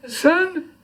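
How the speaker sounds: a high, hushed voice asking son; a lightly voiced dying old man calling out. Dying man speaks in poem.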